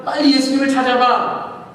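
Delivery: loud, high hurry-up; urgent order. Acted. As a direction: push the others to search. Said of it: "하면서".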